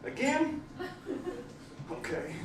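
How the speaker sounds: high incredulous yelp, then soft resignation; high, small, reluctant disbelief; waking-up dismay. Acted. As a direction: wake up baffled, then give in quietly. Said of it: "you wake up and you're like".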